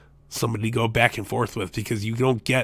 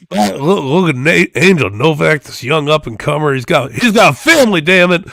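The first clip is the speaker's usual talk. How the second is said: louder, higher excited wrestling play-by-play call; Jim Ross impression; 4 semitones higher, 6 dB louder than usual. Podcaster imitating Jim Ross calling Angel Novak, excited. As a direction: holler it like a veteran ringside announcer. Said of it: "I can't even do Jim Ross right now".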